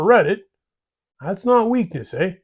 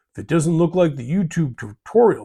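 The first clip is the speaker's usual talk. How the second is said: ordinary delivery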